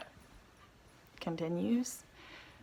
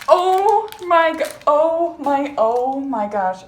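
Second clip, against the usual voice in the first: stunned; 5 semitones higher, 5 dB louder, slower than usual. Real, not acted. Stunned by positive test.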